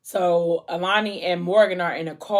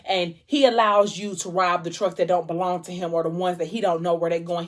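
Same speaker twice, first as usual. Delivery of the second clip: ordinary talk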